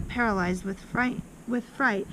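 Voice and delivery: ordinary delivery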